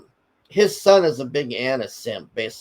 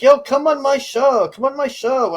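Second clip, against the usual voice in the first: pushy imitation; 10 semitones higher, 4 dB louder than usual. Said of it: "he's just like".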